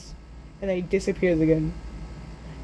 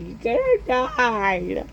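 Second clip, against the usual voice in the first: slow, drawn-out, wobbling cry; mock wail; 2 semitones higher, much slower than usual. Boy to rescued brother, hammed-up drawn-out wail. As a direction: wail it out, stretching the last word.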